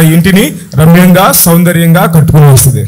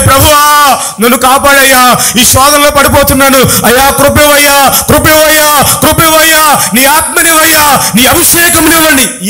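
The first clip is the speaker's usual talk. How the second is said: high, urgent shouted prayer; acted cries of a praying believer; crying out to God; 8 semitones higher than usual. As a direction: cry out desperately for help, stretching the pleas. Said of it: "కేకలు వేయాలి".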